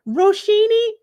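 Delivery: a high, loud mock call of the name across the room; careless nurse call. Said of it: "Here go the nurse, open the door".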